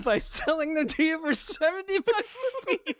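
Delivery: high-pitched giggly delivery; barely holding the laugh together; laughing through a joke. Real, not acted. Telling his Wii U box joke, laughing hard.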